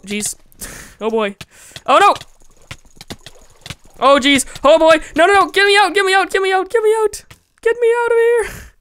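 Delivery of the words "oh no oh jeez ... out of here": high, loud panicked pleading; frantic cries to escape, rising; panicked yelling. Real, not acted. Trapped gamer shouting in panic to get out.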